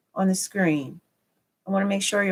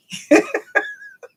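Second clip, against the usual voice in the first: very high, gleeful giggle; laughing. Real, not acted. Streamer feeling like a kid with a new toy, laughing. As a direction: burst into a high, real laugh.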